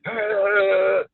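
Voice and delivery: loud, high mock yell; hyped-up growling yell. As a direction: imitate a high-energy hothead's roar. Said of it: "he was real hype".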